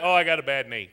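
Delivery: low-pitched imitated complaint; put-on reluctant volunteer; mock excuse-maker. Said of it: "Look, he's got".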